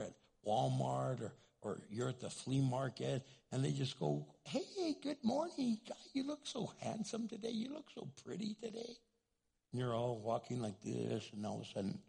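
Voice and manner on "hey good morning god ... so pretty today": high-pitched, gushing compliments; cheery greeting. Acted. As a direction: sing out a friendly hello to a stranger. Cheery stranger's compliment, acted.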